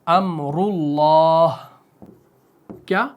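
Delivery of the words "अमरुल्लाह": अमरुल्लाह very slow, रु thick; heavy ru recitation. Shown as a demonstration. Teacher writing another example, slow heavy ru. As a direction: recite अमरुल्लाह with a full-mouthed रु. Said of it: "मुंह भर के पढ़ना है".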